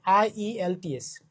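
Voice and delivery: IELTS said slowly, letter by letter: আইইএলটিএস; careful spelling-out. Shown as a demonstration. Teacher correcting a student, spelling out slowly. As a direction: stretch out আইইএলটিএস so each letter is clear. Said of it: "আইএলটিএস, শুনছো তো নাকি?".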